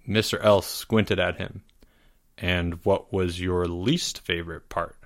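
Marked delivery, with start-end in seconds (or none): 2.4-5.0 slow, lower and softer, measured question. A narrator's reading. Teacher questioning a squinted-at student, slowly. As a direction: ask it quietly and slowly. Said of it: "he asked slowly".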